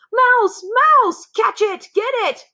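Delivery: very high, loud frantic shouts; shouting cooks. A narrator's reading. Cooks chase the mouse across the floor, shouting. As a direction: raise the alarm at full pitch. Kitchen staff chasing mouse.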